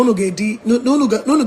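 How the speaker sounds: normal talking voice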